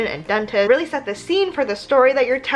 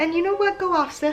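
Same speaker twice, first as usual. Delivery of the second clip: sassy encouragement; 7 semitones higher than usual. Acted. Cheering on her younger self.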